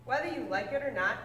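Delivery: ordinary delivery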